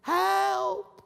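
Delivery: high, strained calls for help from below; shouting for help. Pretend. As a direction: call up from the hole for help. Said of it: "you're shouting".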